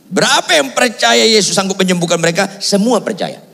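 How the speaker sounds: high, emphatic call to the congregation; rousing question. Real, not acted. Repeats question to rouse congregation.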